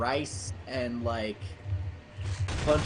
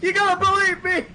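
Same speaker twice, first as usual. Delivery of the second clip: high, loud, panicky pleading; panicked high voice; 6 dB louder than usual. Acted. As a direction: squeal out a desperate excuse. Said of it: "And he's like".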